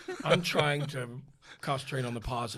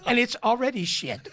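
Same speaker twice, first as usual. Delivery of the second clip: retold with a laugh, higher; husband's jab, laughing; 9 semitones higher, 4 dB louder than usual. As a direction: play the husband's dig through laughter.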